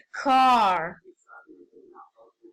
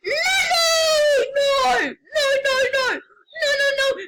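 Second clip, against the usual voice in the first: high, louder panicked protests; 12 semitones higher, 3 dB louder than usual. Real, not acted. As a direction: cry out no over and over in alarm. Game going wrong, panicking.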